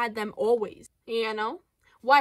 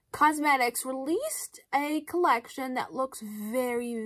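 ordinary talk, nothing marked

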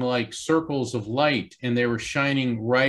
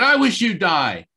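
retold angry cry, higher and louder; angry outburst; 7 semitones higher, 6 dB louder than usual. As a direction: snap it out in a fit of anger. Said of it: "in a fit of anger".

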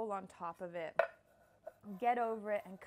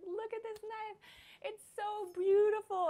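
much higher, gushing over the new knife; excited gushing; 12 semitones higher, 7 dB louder than usual. Retold or imitated. Cook showing chef her new knife, high excited gush. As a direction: squeal with delight at a new toy. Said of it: "I was like".

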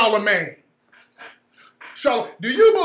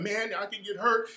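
mock scared; 8 dB quieter than usual. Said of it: "sounding like a bitch who just had a miscarriage".

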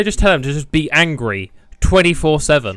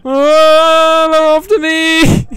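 panicked yell; 8 dB louder, much slower than usual. Real, not acted. Chased by guards in game.

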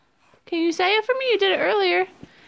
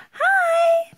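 squealed greeting; 13 semitones higher than usual. Real, not acted. Mother greeting her baby girl, squealing high.

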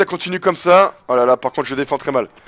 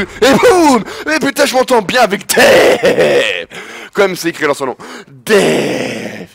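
triumphant shout; 3 semitones higher, slower than usual. Real, not acted. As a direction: bellow it out like he just won. Celebrating dunk.

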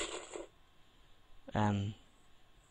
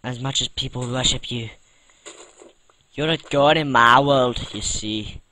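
loud, hammed-up Irish accent addressing a Minecraft block; playful put-on Irish-Scottish lilt, booming at times; mock Irish accent; 9 dB louder than usual. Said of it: "I'm doing a bit of an Irish accent".

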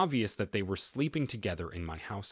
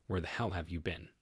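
ordinary delivery

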